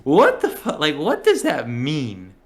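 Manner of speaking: loud, high, slow disbelief; retold loud outraged question; mock outrage. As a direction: shout it in disbelief. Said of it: "we're like".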